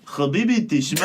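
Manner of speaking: put-on playful Israeli accent. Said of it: "Okay, fine, Israeli, Israeli".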